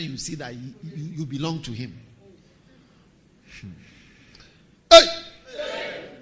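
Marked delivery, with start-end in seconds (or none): 4.9-6.1 sharp call. Real, not acted. Calling out to congregation.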